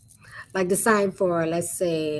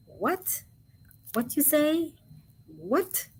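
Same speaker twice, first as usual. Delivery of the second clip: higher, soft questioning with a rising end; a soft, raised-pitch query; 7 semitones higher, 7 dB quieter, much slower than usual. Shown as a demonstration. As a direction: act out asking what was said. Acting out asking a question.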